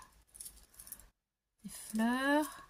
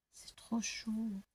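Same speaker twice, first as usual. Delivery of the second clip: soft breathy-free whispering, almost to herself; awed whisper; 6 dB quieter, slower than usual. Real, not acted. Showing her craft haul to viewers, whispering in awe.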